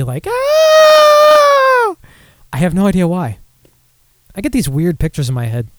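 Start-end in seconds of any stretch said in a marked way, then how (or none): 0.3-2.0 high, loud mock yelp of fright; imitating a friend's scream as he runs from a bee; mock panicked scream. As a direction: shriek like a man fleeing a bee. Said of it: "acting like he's running from a bee".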